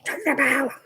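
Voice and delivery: high-pitched mock yell; calling out. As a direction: call the dog that ignores you. Escalating call to dog ignoring her.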